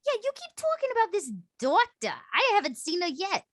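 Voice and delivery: high, animated character voice; impatient game character. Acted. Complaining about the unseen doctor, high impatient character voice.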